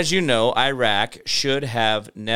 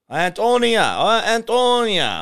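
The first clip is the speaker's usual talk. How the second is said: calling out; 10 semitones higher, 5 dB louder than usual. Real, not acted. Repeated name, growing louder.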